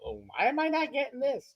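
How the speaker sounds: high whiny complaint; whining voice. Retold or imitated. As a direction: whine it like a spoiled player. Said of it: "I love your whining voice".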